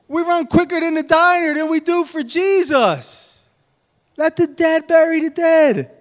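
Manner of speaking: high, loud preacher cry; fiery preaching. Acted. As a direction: preach it hard to the congregation. Preaching at full force.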